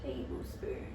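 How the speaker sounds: whispered, to herself. Shown as a demonstration. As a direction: breathe the word Tablespoon without voice. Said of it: "this does say tablespoon".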